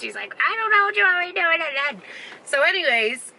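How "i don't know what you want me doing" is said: high-pitched mock exasperation; pissed off. Boyfriend snapping back about the candle, mock pissed off. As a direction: imitate an annoyed man's raised-pitch protest. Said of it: "He was so pissed".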